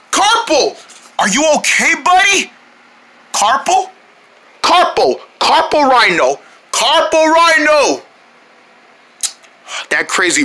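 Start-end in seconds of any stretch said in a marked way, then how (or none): 0.1-8.0 calling out. Real, not acted. Calling for missing friend.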